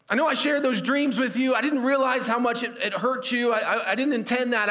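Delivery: high, loud desperate plea from the pit; crying out pleading. Acted. Joseph pleading loudly up to his brothers from the pit, desperate cry. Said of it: "Joseph crying out to his brothers".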